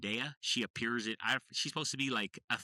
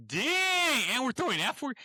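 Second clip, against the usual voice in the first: shocked exclaim; 13 semitones higher, 5 dB louder than usual. Real, not acted. Reacts to friend swearing.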